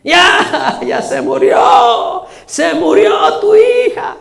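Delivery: high, drawn-out fake lament; mock mourners' wailing. Mourners tell Jairo his daughter died, mock wailing. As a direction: wail slowly like hammed-up professional criers. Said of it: "así estaban allá llorando".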